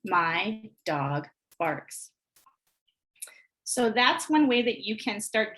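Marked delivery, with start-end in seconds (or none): 0.0-2.1 slow highlighted reading. Shown as a demonstration. Teacher highlighting each word, slow and separated. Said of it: "as you highlight each, um, manipulative".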